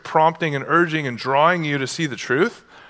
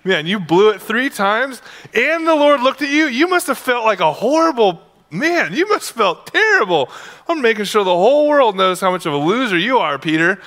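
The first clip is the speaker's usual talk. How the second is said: much higher and louder taunting voice; mocking Luke; 7 semitones higher, 4 dB louder than usual. Luke taunting Peter while writing it down, much higher mock jeer. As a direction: jeer at him like a gleeful schoolyard bully. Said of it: "Luke's like".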